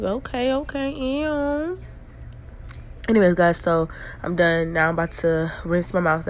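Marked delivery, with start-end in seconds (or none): 0.0-1.8 grossed-out ew. Real, not acted. Disgusted at the toothpaste.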